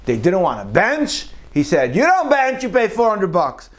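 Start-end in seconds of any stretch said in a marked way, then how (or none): 2.0-3.7 mock demand. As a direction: bark out the price like a stern host. Avram Avinu demanding payment.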